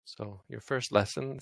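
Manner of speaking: ordinary talk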